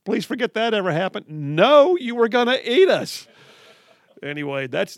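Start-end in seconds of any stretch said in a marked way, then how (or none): 0.0-1.3 slow, high, hammed-up sorry with a laugh; mock sheepish apology. Host mimics the group apologising with a mock sheepish laugh. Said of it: "and then it's all like". 1.3-3.3 loud, high mock outrage; indignant retort. Host retells the ranter's loud indignant comeback. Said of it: "He said".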